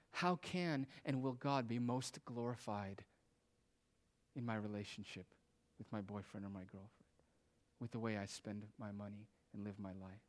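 soft, low, measured asking; quietly voiced humble wondering; humble question. Meek believer's question acted out.

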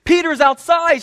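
high, loud, breathless news; excitedly. Acted. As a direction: burst in with the news. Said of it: "she says excitedly".

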